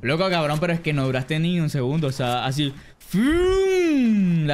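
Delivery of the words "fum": sharp imitated whoosh; whoosh sound effect. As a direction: blurt a quick "fum".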